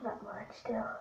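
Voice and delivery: unvoiced whisper. Real, not acted. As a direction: say it under your breath. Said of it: "whispering while trying to sneeze".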